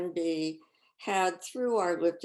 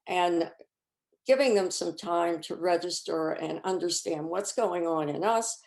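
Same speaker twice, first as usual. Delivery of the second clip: normal talking voice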